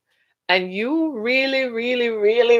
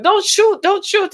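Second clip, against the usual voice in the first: mock panic; 8 semitones higher than usual. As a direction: cry out in mock alarm. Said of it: "before you know it".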